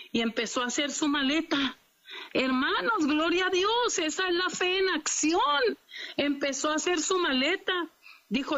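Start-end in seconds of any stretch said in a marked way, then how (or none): 2.3-7.7 high, rising cry of praise; pitched-up preacher's exclamation; exultant preaching. Real, not acted. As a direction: proclaim it jubilantly to the congregation. Preacher exults over prisoner's faith.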